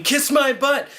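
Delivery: mock scream. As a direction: yell it like a street jerk. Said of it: "running down the street screaming".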